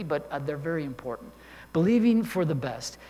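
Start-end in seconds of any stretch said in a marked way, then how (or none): 1.7-3.0 emphatic. Shown as a demonstration.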